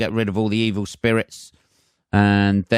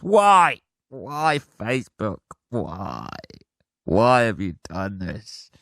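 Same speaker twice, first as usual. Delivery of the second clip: mock despair; slower than usual. On his knees to Facebook, mock anguished wail. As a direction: ham up a broken man pleading. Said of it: "He's on his hands and knees".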